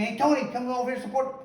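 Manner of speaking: high-pitched imitation of someone calling over; mimicked calling out. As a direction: mimic an insistent summons for support. Mimicking people calling him over.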